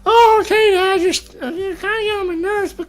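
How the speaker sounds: squeaky, grumbling compliance; grudging child. Acted. Ten-year-old irritated by Dad but agreeing, high-pitched. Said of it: "then he'll be like".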